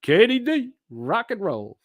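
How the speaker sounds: very high squeaky Katie D; mock cheer. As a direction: sing out the name in a silly high voice. Mocking cheer.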